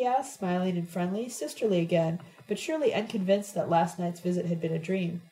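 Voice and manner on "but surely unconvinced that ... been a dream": usual speaking voice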